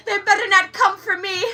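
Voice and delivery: high, loud, crying old lady imitation; elderly neighbor. Imitating the neighbor fretting about Trump, high wail. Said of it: "She'll be like".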